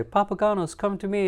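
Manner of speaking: high, affected operatic speaking voice; opera singer. Acted. Coach mocking opera singers talking, high affected voice. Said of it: "opera singers say".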